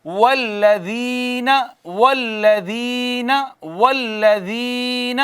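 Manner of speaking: والذین chanted slowly with the long ی held; slow tajweed chant; slow recitation. Shown as a demonstration. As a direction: stretch the ی in والذین one alif. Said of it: "یہ یا مدہ ہے".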